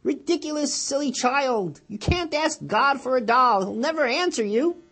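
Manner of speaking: loud, high scornful ridicule; mock her relentlessly; ridiculing brother. Brother ridiculing his sister's prayers, loud and scornful. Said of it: "ridiculing her".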